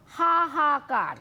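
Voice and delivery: হাহাকার drawn out slowly in three pieces; syllable by syllable. Shown as a demonstration. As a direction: stretch each হা, then কার. Demonstrates next word by syllable.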